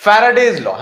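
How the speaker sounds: loud, high call of the topic; announcing loudly. Real, not acted. Teacher announcing the next topic to the class, loudly. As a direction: call out the new topic to grab attention.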